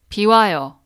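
비 와요 said clearly, word by word; dictionary pronunciation. Shown as a demonstration. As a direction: carefully model 비 와요 for learners. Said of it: "It's raining" in Korean is".

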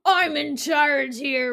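slow and weighty, like a boss taking charge; mob boss. Retold or imitated. Mob boss takes over.

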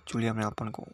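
usual speaking voice

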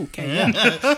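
high, loud, laughing; laughing excitement. Retold or imitated. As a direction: answer eagerly through laughter. Eager kid reply, laughing.

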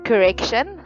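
ordinary delivery

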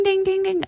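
playful high bell imitation; imitated bell ding. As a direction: chime it like a bright idea bell. Light bulb idea sound.

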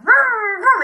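high revving chainsaw noise. Retold or imitated. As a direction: rev it like a chainsaw. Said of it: "he's just like".

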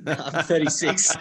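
amused reveal through laughter; laughing. Real, not acted. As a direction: chuckle out the admission of age. Laughing, admitting his age.

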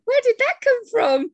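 put-on high, loud outburst in an Irish accent; angry Irish accent. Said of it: "suddenly the Irish comes out in me".

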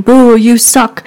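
put-on slow, jeering child's taunt; mock a bratty eight-year-old booing; imitating a little kid. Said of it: "the little eight-year-old saying".

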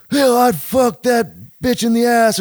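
put-on high, loud drunken holler; drunken shout. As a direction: yell it like a drunk wrestler. Drunk guy shouting from stage.

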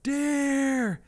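high, loud urgent call of his name; wife calling out his name in alarm. Retold or imitated. Wife calls husband during tornado, high loud alarm.

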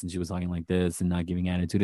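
low, soft, quick monotone. Retold or imitated. Host imitating a boring contestant's acting, monotone. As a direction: drone it with no attitude. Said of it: "her monotone voice".

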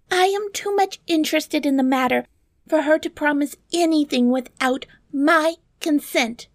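high, insistent princess voice; protesting princess. Acted. Princess contradicts the dwarf's claim, high protesting voice. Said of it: "interrupted the princess".

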